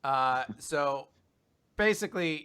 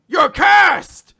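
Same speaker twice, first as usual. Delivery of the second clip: screamed much higher and louder, You're cursed hit hard; furious shout; 17 dB louder than usual. Retold or imitated. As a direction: scream the accusation at your brother. Langris yells at Finral.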